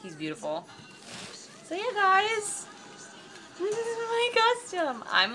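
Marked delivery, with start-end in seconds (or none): 1.6-5.0 high, slow, squealing voice; drawn-out, high-pitched delight; squeaky delight. Real, not acted. Presents the adored horse to viewers.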